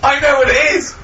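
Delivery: high, laughing burst of excitement; excited. Real, not acted. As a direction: blurt it out, thrilled. Quiz guessing excitedly.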